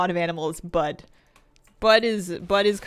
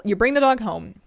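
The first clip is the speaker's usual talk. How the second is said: normal talking voice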